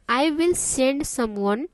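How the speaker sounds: very slow, each word laid out; slow and clear. Shown as a demonstration. Teacher reading the receptionist's line slowly, deliberate. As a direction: spell it out slowly for learners. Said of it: "मैं इतना आराम आराम से इसलिए बोल रही हूँ".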